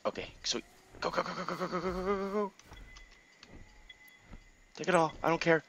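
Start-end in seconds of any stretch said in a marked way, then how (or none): 1.0-4.9 quick, high, soft stutter of gos; hushed high machine-gun chant; rapid-fire urging. Real, not acted. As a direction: urge on in a rattling stream. Urging character to run.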